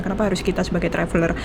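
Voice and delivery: usual speaking voice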